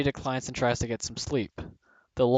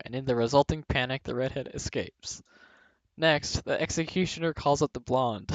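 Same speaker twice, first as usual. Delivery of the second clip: normal talking voice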